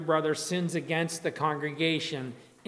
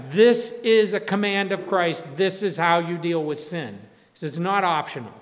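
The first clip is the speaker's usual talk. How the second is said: louder, firm insistence on each statement; emphatic re-stressing; 6 dB louder than usual. Real, not acted. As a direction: drive the command home to the congregation. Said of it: "I wanna just re-stress".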